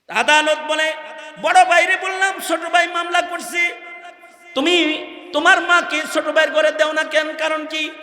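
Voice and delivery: high, loud declamation of a courtroom tale; high-pitched pulpit storytelling; impassioned preaching. Acted. Preaching at full force.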